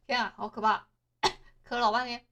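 hoarse and quiet after choking on saliva; recovering from choking, voice strained. Real, not acted. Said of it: "我刚刚被自己的口水呛到".

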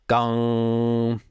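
a held, humming imitation of a struck gong; sung gong tone. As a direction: hum out the ring of a gong and let it hang. Imitating a gong note in lesson.

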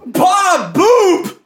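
high, delighted exclamation; surprised greeting. Acted. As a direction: greet him in mock surprise. Greets the caller character.

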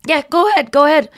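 high, loud forced casual yes; hammed-up nervous okay; nervous agreeing. Rachel nervously letting Ross use the phone, high and forced. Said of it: "she's like".

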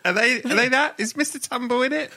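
high, squeaky clueless voice; silly squeaky question. Pretend. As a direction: play dumb in a squeak.

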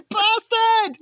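very high indignant exclamation; mock outrage. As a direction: imitate a listener's outburst at a villain. Editor's outburst at the story, retold.

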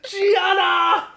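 high, loud hysterical howl; howling with laughter. Acted. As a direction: wail it out, helpless with mirth. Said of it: "She again started to howl".

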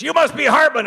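high, gruff imitation of a crotchety old man. [name] greets the host in a crotchety old man voice. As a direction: bark the greeting like a grumpy elder. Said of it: "kinda crotchety old man".

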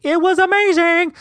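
very high, held squeal on "it was amazing"; exaggerated high gush. Vlogger sells the breakfast to viewers, exaggerated high gush. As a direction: gush it in a silly, sky-high voice.

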